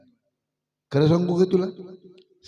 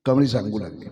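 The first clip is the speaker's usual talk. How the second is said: ordinary delivery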